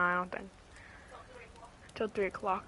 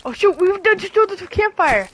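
frustrated yell; 10 semitones higher, 6 dB louder than usual. Real, not acted. Campfire ruined in game.

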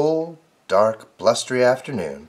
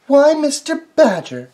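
high, louder scolding squirrel; crying out; 14 semitones higher, 6 dB louder than usual. Acted. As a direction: cry out at him in a high voice. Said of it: "cried Missus Squirrel".

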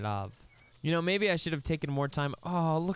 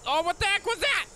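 much higher, louder yelp; startled; 5 dB louder than usual. Real, not acted. Gamer startled by a dinosaur cry, high yelp. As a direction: jump at a sudden noise.

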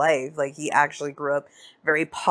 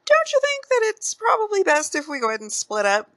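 mock high voice; 14 semitones higher than usual. Suspicious partner proposing split.

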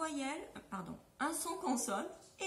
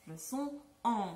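Le son en said slowly, nasal en held; slowed en demo; 4 semitones lower than usual. Shown as a demonstration. Teacher repeating en slowly for class. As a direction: give the en one more careful model. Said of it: "un peu nasal".

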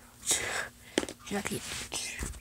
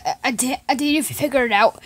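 ordinary talk

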